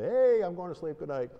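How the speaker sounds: loud, high mock call-out; brash talk-back. Telling a night-time voice to let you sleep, brash mock call-out. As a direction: shoo the voice off with a jaunty high shout.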